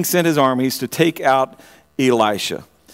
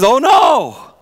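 panicked cry; 6 semitones higher, 4 dB louder than usual. Acted. Frightened servant acted out.

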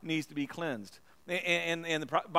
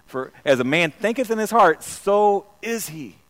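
louder and slower, leaning on heart; emphatic loud repeat; 4 semitones higher, 9 dB louder than usual. Shown as a demonstration. Preacher repeating the verse to the congregation, loud and emphatic. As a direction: hammer the verse home the second time. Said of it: "I can't get away from King James".

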